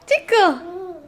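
high giggling sing-song tickle; playful tickle chant between giggles; giggly tickle attack. Real, not acted. Tickling babies' toes.

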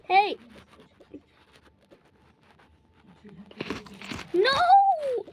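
high, very loud yell of protest. Acted. Sibling losing the fight over the snack, yelling protest. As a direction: cry out as it gets snatched.

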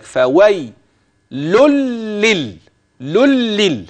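"لل" repeated high with a held double l; teacher repeating "لل" as a model; doubled l drill. Shown as a demonstration. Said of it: "هكذا".

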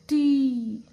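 टी loud, with a long ee; long-vowel demonstration. Shown as a demonstration. Teacher joins letter and matra, long loud टी. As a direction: stretch टी out loudly. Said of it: "क्या बना?".

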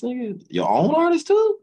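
high, loud, disbelieving retold yell; incredulous mock outburst. Coworkers confronting him about the artist, high incredulous yell. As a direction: throw the question back in shock.

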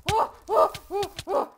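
string of short high "oh" bursts; loud high whoops. Real, not acted. Player in mid-game action, short loud whoops. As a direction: burst out with rapid loud hoots.

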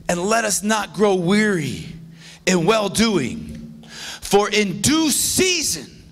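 slow, deliberate verse reading; measured pulpit recitation; slow scripture reading. Acted. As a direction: let each phrase land. Pastor reads verse slowly.

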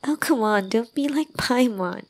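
ordinary talk, nothing marked

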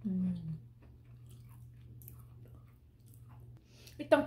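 talking between bites; chewing while talking. Real, not acted. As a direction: mouth partly full. Seen 3: Bites at -3, chews with closed mouth until about 4s.

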